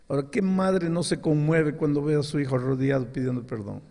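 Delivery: ordinary talk, nothing marked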